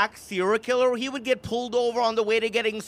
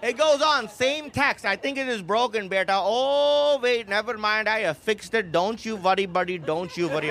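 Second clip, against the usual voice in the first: mock Indian accent of a fussing mom, voice close to his own; 3 semitones higher than usual. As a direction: read mom's texts in a light Indian accent. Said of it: "text messages have Indian accents".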